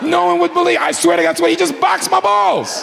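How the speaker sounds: high-pitched incredulous hollering; scandalized protest. Retold or imitated. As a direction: swear to it in comic outrage. Protesting the story's absurd climax.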